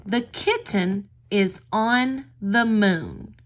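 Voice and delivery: put-on reading the sentence slowly to a young child; slow reading to a toddler. Shown as a demonstration. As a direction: read it out slowly for little kids. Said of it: "Look at the sentence".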